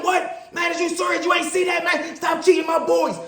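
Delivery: high shouted protest; reliving his own outburst at the cheating ref, high shout; losing my cool. Retold or imitated. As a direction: yell at the ref like you've been robbed. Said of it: "I lose my cool a little bit".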